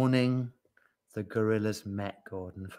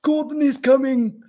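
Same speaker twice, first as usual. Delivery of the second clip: high, loud seal-like bark; 8 dB louder than usual. A narrator's reading. Seal passes news to the yak, barking. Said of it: "barked the seal".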